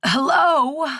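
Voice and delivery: put-on high, quavering old crone. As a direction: call out like a frail old hag. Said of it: "came the old crone voice".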